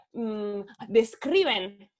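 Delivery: usual speaking voice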